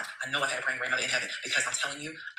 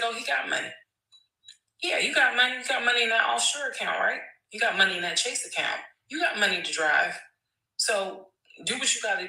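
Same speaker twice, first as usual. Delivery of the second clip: retold yelling; 8 semitones higher than usual. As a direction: shout it over the phone call. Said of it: "I'm yelling".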